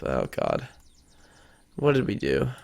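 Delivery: ordinary delivery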